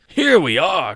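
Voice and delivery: loud, high delighted cry; a king pouncing on a lucky coincidence; cried out. Acted. The king crows at finding eight players, loud and high. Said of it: "cried the king".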